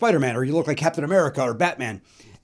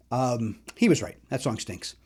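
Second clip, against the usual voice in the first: ordinary talk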